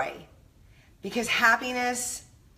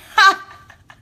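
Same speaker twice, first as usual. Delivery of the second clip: loud burst of laughter; 11 semitones higher, 12 dB louder than usual. Real, not acted. Laughing at her own rant.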